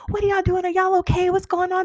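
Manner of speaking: high-pitched concerned questioning; retold alarm. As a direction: imitate friends rushing in to check on everyone. Friends rush in, retold, alarmed.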